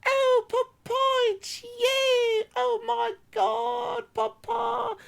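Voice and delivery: put-on very high, drawn-out falsetto child voice; hammed-up tearful reunion squeal; overjoyed dumb kids. Kids greet their long-lost papa, mock-tearful high falsetto. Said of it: "And they're like".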